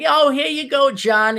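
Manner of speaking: high, doting New York mom voice; exaggerated New York mom. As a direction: fuss over a grown son like a sitcom mother. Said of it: "this T V show New York mom".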